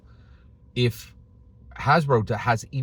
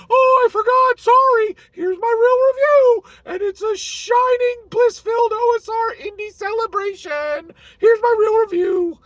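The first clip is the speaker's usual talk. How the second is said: very high, loud, squeaky mock reviewer voice; 8 dB louder than usual. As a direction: parody the turncoat reviewer in a shrill falsetto. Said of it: "five days later".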